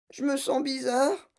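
high, choking squeak; choked. A narrator's reading. Daughter swelling like a balloon, choked voice. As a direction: say it high as if short of air. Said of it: "suffoqua Violette".

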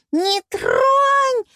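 very high, loud squealed command; shriek. A narrator's reading. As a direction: squeal to stop someone grabbing it. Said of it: "взвизгнула та". (пронзительно взвизгнуть)

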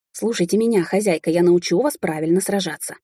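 plain reading voice

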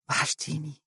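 very soft intimate whisper. A narrator's reading. Fares whispering to Mohra, very soft whisper. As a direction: breathe it close to her. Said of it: "وقال هامسا".

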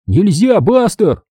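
high-pitched scolding yell. A narrator's reading. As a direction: yell a desperate command at the dog. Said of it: "завопил я". (вопль, команда собаке)

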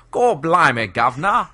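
loud, high mock Cockney shout; hammed-up TV-cartoon Cockney; fake British accent. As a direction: belt out a cliché Cockney accent, laughing at the end. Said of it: "cutaway to Britain and everyone's".

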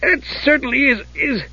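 high kender voice, lost for words; disgusted Tass. Acted. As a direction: falter in disgust, pitched high.

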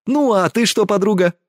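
high-pitched, playful probing; sly teasing. A narrator's reading. Girl turning the subject to her friend, slyly. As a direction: turn it on her slyly, voice lifted. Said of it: "Лукаво посмотрела она".

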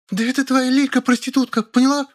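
very high, furious retort; fly up at her; flaring outrage. A narrator's reading. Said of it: "взвилась Зайка". (вспыльчивый визг)

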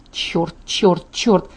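low, soft resigned swearing; muttered cursing. Acted. Bride caving in to her friend, muttered cursing. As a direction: curse under the breath, dropping pitch. Narrator's heroine giving in, cursing.

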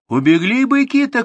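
high, loud alarmed shout; far-carrying high holler; calling out. A narrator's reading. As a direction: call across the distance in alarm. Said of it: "крикнул он".

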